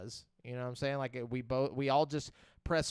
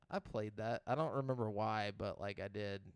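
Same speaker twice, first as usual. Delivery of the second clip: quieter, lower musing to oneself; imagined offhand recollection; 5 semitones lower, 6 dB quieter than usual. Pretend. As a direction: toss it off quietly, looking back. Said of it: "ten years and be like".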